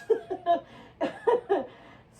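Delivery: bright burst of laughter; loud laugh. Real, not acted. Laughs at own stretch.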